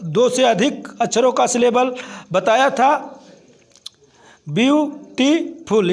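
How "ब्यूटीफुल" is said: ब्यूटीफुल slowly in three parts, ब्यू, टी, फुल; split into syllables. Shown as a demonstration. Teacher counting three syllables, ब्यूटीफुल split slowly. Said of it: "इसमें तीन syllable थे".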